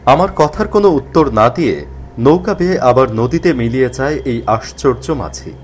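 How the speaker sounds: ordinary delivery, nothing marked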